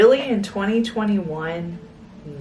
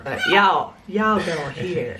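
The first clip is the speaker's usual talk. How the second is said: lower, drawled Texas twang; 5 semitones lower than usual. Pretend. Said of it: "That's your Texas".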